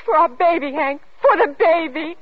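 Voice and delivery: urgent pleading. Acted. Wife begging husband for the baby's sake, pleading. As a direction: beg him with rising desperation.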